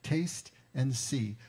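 "Taste and see" drawn out very slowly, each word weighed; slow emphatic. Shown as a demonstration. Preacher savouring the psalm verse, slow emphasis. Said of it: "Notice the verbs".

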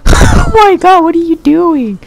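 high, loud panicked ow and no; panicked yelps. Real, not acted. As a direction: yelp as you take hits. Hit by zombies, panics.

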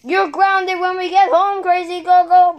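high loud scolding; ground the naughty kid; mock parent scolding. Scolding the thief.

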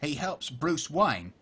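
Cockney impression, "Caine" turned to "kine"; unhurried Cockney with wide vowels; Michael Caine. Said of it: "That was a really good impression".

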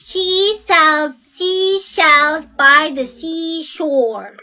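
slow, careful tongue twister; careful slow recital. Acted. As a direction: read it very slowly, each word separate. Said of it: "you must read it very slowly".

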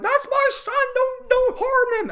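hammed-up pleading mom voice; mock pleading mother. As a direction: whine it like a frantic mother. Retold mother pleading for son.

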